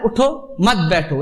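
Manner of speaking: "उठो", short break, then "मत बैठो" together; group "मत बैठो" apart from "उठो"; pause after उठो. Shown as a demonstration. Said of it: "मतलब ऊपर उठना है". ("उठो" पर रुककर "मत बैठो")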